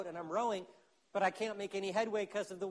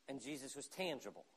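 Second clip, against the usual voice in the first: normal talking voice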